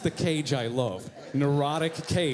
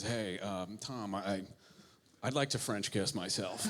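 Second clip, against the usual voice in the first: a hint of Nick, lower and softer; Nick Cage voice; 6 semitones lower, 9 dB quieter than usual. Director imitates Nick Cage pitching an idea, lower and softer. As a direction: lightly mimic Nick's low, soft musing. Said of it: "he comes and says".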